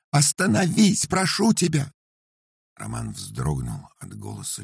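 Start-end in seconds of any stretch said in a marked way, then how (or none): 0.1-1.9 high, loud begging cry; pleading scream. A narrator's reading. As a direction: scream at him to stop. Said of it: "послышались крики Татьяны".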